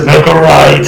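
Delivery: a light imitation of a booming haunted-house voice; booming guide voice. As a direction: mildly boom the direction out. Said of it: "It's still booming".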